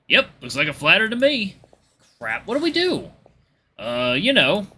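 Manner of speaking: two friends puzzling over trouble, lifted pitch; casual banter in character. Acted. Friends appraising a flat tire, baffled banter.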